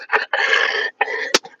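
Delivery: breathless cackle; cackling laugh. Real, not acted. Man joking about a jury of peers, cackling laugh. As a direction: crack up at your own joke. Cracks up at own joke.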